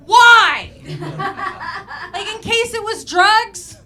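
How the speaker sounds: high, loud disbelieving burst; bewildered outburst at the absurdity; loud incredulous why. Acted. Mock puzzlement at autopsy.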